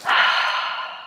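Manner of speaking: long breathy sigh out; demonstrated relaxing sigh; audible exhale. Shown as a demonstration. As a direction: let the breath go in one long unvoiced sigh. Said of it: "and exhale".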